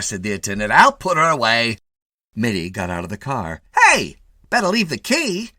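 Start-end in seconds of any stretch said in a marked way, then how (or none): 0.7-1.8 high, loud cocky attendant; brash attendant. A narrator's reading. 3.8-5.5 high, loud brash call; cocky call. A narrator's reading. Attendant calling after him.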